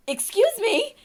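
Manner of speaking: high, loud retold challenge; indignant challenge. Confronting woman who grabbed husband.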